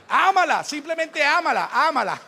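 ámala cried out loud and high, then slowly; "ámala" shouted, stretched on the second one; urgent repeated ámala. Shown as a demonstration. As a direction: preach "ámala" with rising force. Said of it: "Síguela amando".

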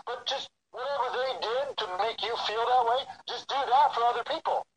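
lightly voiced wife, brushing it off as obvious; wife's simple advice. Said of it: "she's like".

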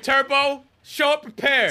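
high, loud call-out; shouting. Real, not acted. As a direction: yell it at him through the mic. Calling out friend in chat.

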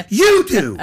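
loud, high, exasperated comeback; worked-up, shouted retort; emphatic rebuttal. Acted. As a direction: throw the complaint straight back. Rebutting the imitated complaint.

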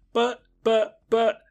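high-pitched mock stammering; flustered, halting imitation of a boy; imitated stutter. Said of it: "Tim starts to stutter".

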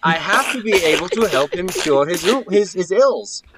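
loud cover story. Acted. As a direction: blurt it loudly and high as a cover. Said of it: "You don't have to shout in my face".

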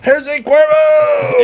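long slow high yell; drawn-out shout. Real, not acted. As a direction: call it out long and loud. Toasting the tequila.

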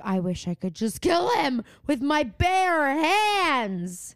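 slow, menacing mock threat; a slow, half-hammed menacing quote; imitating Nate. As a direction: drag it out like a brooding boyfriend. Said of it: "and he's like".

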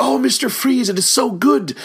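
high-pitched, gushing mock excitement; imitating thrilled fan. Ukrainian man meets Paul Frees backstage, imitated gushing delight. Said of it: "came in and was like".

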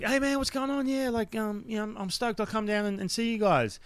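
high, eagerly faked phone voice; put-on enthusiasm. Phoning the producer for a chance on Ninja Warrior, forced enthusiasm. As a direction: act stoked, pitched well up. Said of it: "I have to sound kind of enthusiastic".

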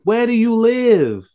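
high-pitched, incredulous scolding; comic outraged disbelief; exasperated rant. Acted. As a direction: pitch it way up, berating a fool. Berating film character for answer.

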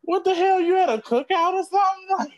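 high, disbelieving outburst; what the hell, way up high; shocked. Real, not acted. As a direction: pitch jumps up in disbelief. Shocked at eating so much.

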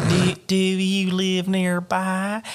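hammed-up country-accented old lady. A narrator's reading. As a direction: stretch it into a high country twang. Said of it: "she has a country accent to me".

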